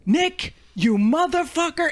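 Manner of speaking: high, drawn-out yell of outrage; retold scream. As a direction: draw out a high-pitched mock yell. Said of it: "I fucking start screaming".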